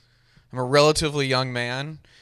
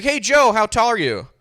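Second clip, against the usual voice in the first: mocking questioner; 8 semitones higher, 4 dB louder than usual. Someone asking his height.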